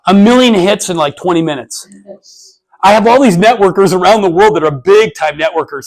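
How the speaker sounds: normal talking voice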